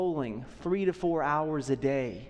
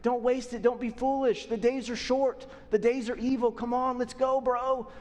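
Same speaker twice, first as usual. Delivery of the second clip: higher, urgent pleading, like a coach urging friends on; Paul pleading; 5 semitones higher than usual. Retold or imitated. Paul urging believers not to waste time, pleading higher. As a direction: beg the believers to get moving, higher and urgent. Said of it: "I picture Paul here almost pleading".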